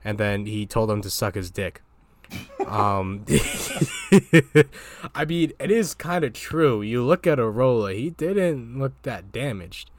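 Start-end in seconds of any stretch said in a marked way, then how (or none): 3.3-5.1 loud high laugh; burst of laughter. Real, not acted. Laughs at the boxer's quote.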